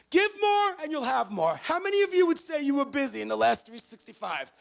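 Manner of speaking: high, loud motivational shout; rally the whole crowd at full voice; fired-up shouting. Shown as a demonstration. Shouted motivational push to audience.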